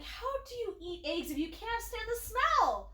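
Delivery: high-pitched, swooping retold question; mock incredulity. Daughter questions mother about eating eggs, mock incredulous. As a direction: play up the bafflement, pitch climbing high.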